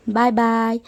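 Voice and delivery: plain reading voice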